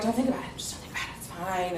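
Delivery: hushed whisper to herself, eyes closed; whispered self-talk. Retold or imitated. To herself during sex, a hushed calming whisper. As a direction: murmur it like calming yourself down. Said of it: "I close my eyes and I'm like".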